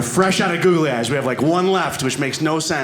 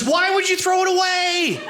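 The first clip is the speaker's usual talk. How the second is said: very high, slow mock outrage; shrill wife. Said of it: "And she goes".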